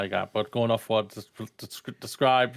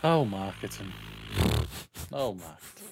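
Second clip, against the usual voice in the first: weary sigh; 7 semitones lower, 6 dB quieter than usual. Real, not acted. Despairing sigh.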